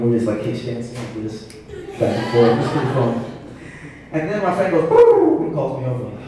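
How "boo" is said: high, loud hooting bird call. Acted. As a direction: hoot a sharp signal across the club. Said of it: "we use the bird call".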